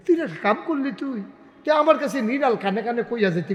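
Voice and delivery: high-pitched acted scolding; acted fatherly reproach; reproachful father. Acted father scolding returned son.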